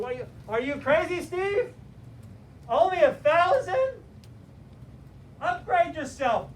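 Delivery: future self voice. Retold or imitated. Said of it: "he's going".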